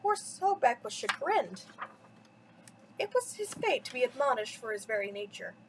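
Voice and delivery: ordinary delivery, nothing marked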